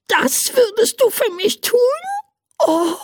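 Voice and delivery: very high squeaky voice, touched and delighted; overjoyed tiny creature. Acted. Tiny creature thanks the man for his slippers, squeaky overjoyed. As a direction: gasp with happy disbelief at the gift. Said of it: "Pipo war überglücklich".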